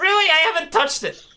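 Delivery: high-pitched disbelief; incredulous protest. Real, not acted. Viewer told the video keeps restarting, incredulous. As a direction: protest it, voice jumping up. Blamed website restarts by itself.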